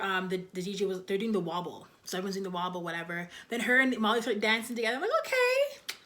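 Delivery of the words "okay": high, stretched-out surprised okay with a rising end; a squeaky, pleasantly shocked okay. Retold or imitated. Reacting to them dancing together.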